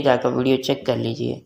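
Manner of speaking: ordinary delivery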